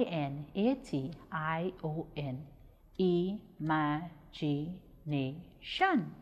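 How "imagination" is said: "Imagination" very slowly in five separate syllables. Shown as a demonstration. Teacher counting out syllables of "Imagination", slow and separated. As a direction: break "Imagination" apart with a pause after each syllable. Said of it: "five syllables in the word imagination".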